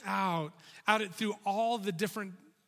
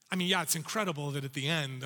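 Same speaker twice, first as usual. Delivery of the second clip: normal talking voice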